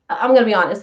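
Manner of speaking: ordinary talk, nothing marked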